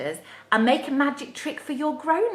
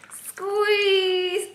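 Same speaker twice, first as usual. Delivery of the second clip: shout squeeze; 8 semitones higher than usual. Shown as a demonstration. Teacher leading children in a shouted word. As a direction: call it out big for the kids. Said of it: "Should we shout squeeze?".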